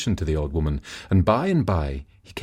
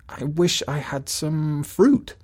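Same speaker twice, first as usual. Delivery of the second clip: sick wife's evasive reply; 7 semitones higher, slower than usual. Acted. Wife hides truth, sick.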